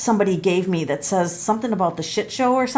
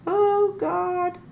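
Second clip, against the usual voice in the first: high, breathy "Oh God" in shock; sudden high astonishment; shocked gasp; 10 semitones higher than usual. Real, not acted. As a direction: gasp it out, startled by the card. Shocked by the cards.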